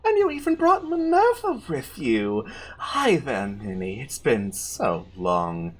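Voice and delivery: mock-tender villain, ending hushed and low; menacingly sweet villainess. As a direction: greet prey like a long-lost darling. Zafira greets terrified Minerva.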